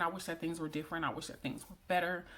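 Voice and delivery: ordinary talk, nothing marked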